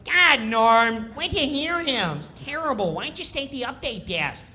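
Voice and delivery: high, loud whining needle; whiny Kattan. Retold or imitated. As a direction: nag him in a high whine. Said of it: "Kattan starts".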